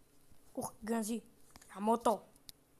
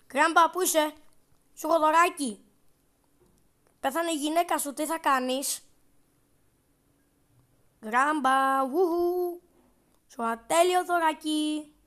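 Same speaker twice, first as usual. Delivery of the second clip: louder, teasing singsong call; mocking taunt; 3 semitones higher, 4 dB louder, slower than usual. Real, not acted. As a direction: lure grandpa out mockingly. Πειράζει τον παππού. (κοροϊδευτικό κάλεσμα)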